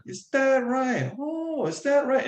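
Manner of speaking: imitating grandmother's lilting tone; grandmother's Japanese lilt. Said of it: "this very particular way of saying it".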